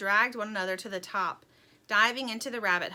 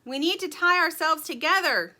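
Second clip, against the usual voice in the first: loud, higher urgent shout; yelling; 7 semitones higher, 8 dB louder than usual. A narrator's reading. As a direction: shout the plan over the storm. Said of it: "yelled Dirt".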